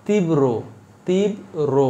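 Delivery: তীব্র twice as tib-ro, a lone r in the second syllable; wrong pronunciation. Shown as a demonstration. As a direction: break তীব্র into tib and ro, single b, pausing between takes. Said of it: "ভুল এটা". (তীব্র: তিব-রো, ভুল উচ্চারণে ব দ্বিত্ব ছাড়া)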